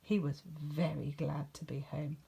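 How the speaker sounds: ordinary delivery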